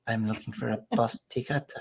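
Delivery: soft, put-on Scandinavian accent; sexy Scandinavian accent. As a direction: imitate a sultry Scandinavian, softly. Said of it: "they say something bland and it sounds hot".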